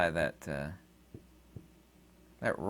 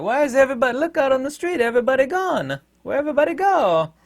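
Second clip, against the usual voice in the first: high, loud, dim-witted voice; clueless bystander; 13 semitones higher, 7 dB louder, faster than usual. Retold or imitated. Oblivious townsfolk asking where everyone went, high and dopey. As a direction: play a dazed simpleton looking round.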